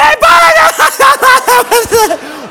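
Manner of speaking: loud, high babble collapsing into cackles; imitated helpless laughing fit; breathless mocking laughter. Girlfriend can't breathe while answering him, high shrieking laughter. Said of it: "she can't breathe".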